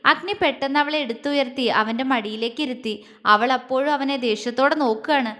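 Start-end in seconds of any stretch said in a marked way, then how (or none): none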